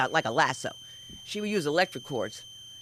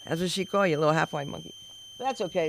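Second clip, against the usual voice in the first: usual speaking voice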